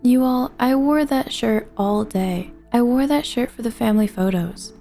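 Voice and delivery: plain reading voice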